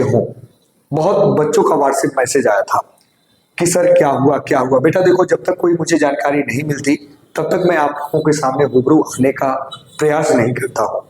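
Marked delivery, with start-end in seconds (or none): none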